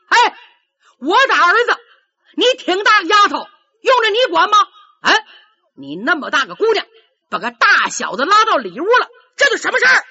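put-on high, loud furious scolding of a stranger girl; angry outburst. Old father shouting at the girl who hid his son, furious. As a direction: snap at her, rising to a tearful yell. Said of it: "钱大成急了".

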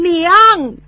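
high tearful call of 娘; crying out for mother. Acted. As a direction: cry out to his mother through tears. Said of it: "喊了一声". (哭喊一声娘)

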